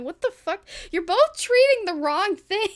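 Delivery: exasperated outburst. Retold or imitated. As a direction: throw up your hands at the doctors. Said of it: "You're like".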